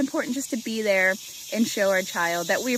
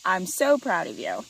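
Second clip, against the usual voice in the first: loud, higher-pitched praise; super encouraging; 5 semitones higher, 7 dB louder, slower than usual. Shown as a demonstration. Parent praising child who succeeded, super encouraging. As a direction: cheer them on with punchy, proud praise. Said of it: "Just being super encouraging".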